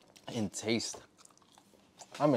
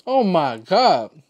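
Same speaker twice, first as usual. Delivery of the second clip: blissful exclamation; 5 semitones higher, 8 dB louder, slower than usual. Real, not acted. Food ecstasy.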